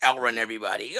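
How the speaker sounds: low, quiet villain purr; evil villain voice. Retold or imitated. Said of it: "you gonna be like".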